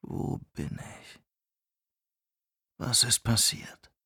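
leises, benommenes Flüstern; geflüstert. Acted. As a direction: verwirrt ins Leere hauchen. Benommenes Erwachen, innere Frage. (kaum hörbar, desorientiert flüsternd)

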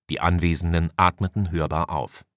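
ordinary delivery

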